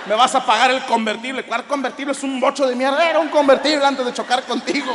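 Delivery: high, heated mock argument; acted shouting match. Two Mexican taxi drivers arguing over a crash, heated shouting. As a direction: hurl indignant accusations as in a comic quarrel.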